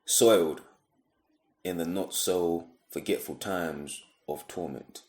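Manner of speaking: spoken-word poem, measured phrases with long pauses; deliberate spoken-word delivery; poetry recitation. Acted. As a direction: recite it line by line, letting each phrase fall.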